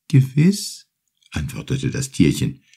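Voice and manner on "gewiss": high, slow, small turtle voice calling his name; little turtle calling. Acted. Grateful little turtle calls the fisherman from the sea, high and slow. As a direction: call up gently from the water like a tiny turtle.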